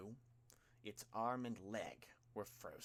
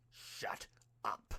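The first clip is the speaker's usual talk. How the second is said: growled; 5 dB louder than usual. A narrator's reading. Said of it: "the child at Izuku's throat growled".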